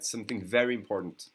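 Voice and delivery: ordinary talk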